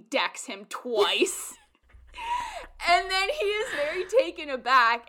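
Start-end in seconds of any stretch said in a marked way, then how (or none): 1.6-5.1 laughing through the words. Real, not acted. Fan retelling the fight scene, laughing hard. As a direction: crack up while telling it.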